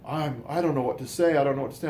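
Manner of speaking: lost for words under stress; imitating stressed. Brother-in-law stunned by fire news, flustered and strained. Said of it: "he was just under a lot of stress".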